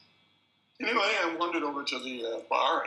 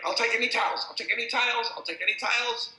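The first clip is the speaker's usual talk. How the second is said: much higher, loud repeated call; calling out bets; 10 semitones higher, 6 dB louder than usual. Retold or imitated. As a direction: call the bets over the crowd.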